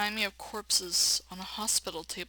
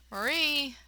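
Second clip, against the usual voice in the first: calling her name; 7 semitones higher, 3 dB louder than usual. Acted. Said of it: "attempt to voice her name".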